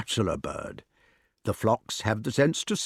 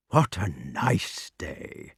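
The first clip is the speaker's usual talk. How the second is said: soft, slow, lower caress; 3 semitones lower, 4 dB quieter, much slower than usual. Shown as a demonstration. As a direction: coo it lovingly. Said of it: "in caressing tones".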